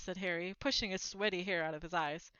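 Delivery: ordinary delivery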